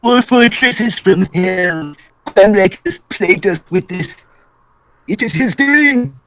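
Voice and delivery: high, slow paranoid conspiracy theorist; raving about a plot, pitched high and slow; Mister Crocker conspiracy voice. Acted. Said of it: "That was a nice conspiracy theorist voice".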